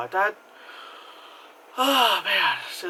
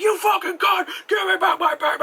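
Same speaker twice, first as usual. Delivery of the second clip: much higher, louder mock rage; furious shout; 5 dB louder than usual. Husband on the phone yelling at his runaway wife, mock furious. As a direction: scream it down the phone at her.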